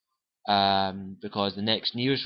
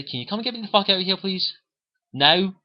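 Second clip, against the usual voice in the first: fast, much higher and louder, desperate; frantic plea; 9 semitones higher, 5 dB louder than usual. Retold or imitated. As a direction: beg the ship to come right now. Calling the Normandy for pickup.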